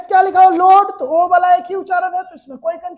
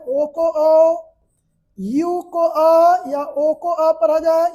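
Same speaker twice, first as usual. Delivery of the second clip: very slow, spaced words for copying; slow dictation; much slower than usual. Shown as a demonstration. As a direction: dictate slowly so they can write. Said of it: "तो पढ़े ओ को ओ पढ़ा जाए". (धीरे-धीरे लिखवाने वाला उच्चारण)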